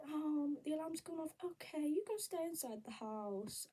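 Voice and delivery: breezy high imitation of the agency; mock agency voice.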